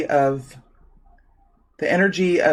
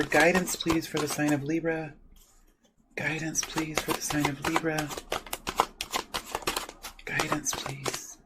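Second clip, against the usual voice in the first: softly chanted plea for guidance; soft invocation; 6 dB quieter than usual. Real, not acted. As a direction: murmur a gentle repeated request. Calls for guidance while shuffling.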